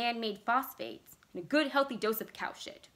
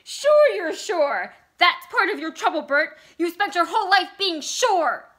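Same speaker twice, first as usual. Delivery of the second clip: high, very loud tirade; hysterical cry; 9 semitones higher, 13 dB louder than usual. A narrator's reading. Vicky berating Bert for always being sure, hysterical. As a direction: yell it at him, pitched high all through. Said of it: "she cried hysterically".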